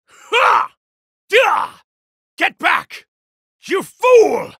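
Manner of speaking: high, loud strike cries; sharp furious battle yells; attack shouts. Acted. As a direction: yell with each blow.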